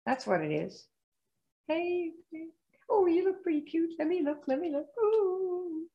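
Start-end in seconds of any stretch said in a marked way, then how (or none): 1.7-5.9 soft, high, flirty come-on. Retold or imitated. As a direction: flirt softly, charm them in. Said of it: "to romance 'em".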